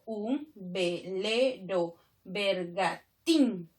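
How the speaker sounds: very slow, every syllable set apart; teacher's slow scansion, counting out each syllable. Shown as a demonstration. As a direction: split the verse into syllables with gaps. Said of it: "Si yo separo nada más".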